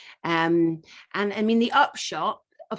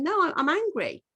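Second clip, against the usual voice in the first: higher, emphatic admission of anger; declaring anger; 10 semitones higher than usual. Retold or imitated. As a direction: blurt out that you're angry. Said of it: "when I started going".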